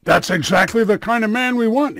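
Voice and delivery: loud, rough retort; angry bully. Acted. Angry burly man snaps back at the rabbi, loud. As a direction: shove the words at him angrily. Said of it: "the burly man gets really angry now".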